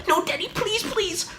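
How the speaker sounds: put-on whimpering kid voice, begging; pleading child. Kid begging Daddy not to ring the bell, high pleading.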